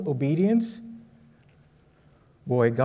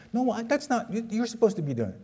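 higher, fast defensive retort; arguing dad; 7 semitones higher, 5 dB quieter, faster than usual. Retold or imitated. Dad snapping back at mom in the front seat, higher and fast. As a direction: pass the blame back quickly.

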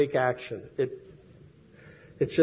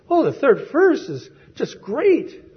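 much higher, slow, gushing praise; mock thrilled printer; 12 semitones higher, 3 dB louder than usual. Printer praising the third verse to Frances, hammed-up gushing. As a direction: rave about the verse, hammed up. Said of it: "He says".

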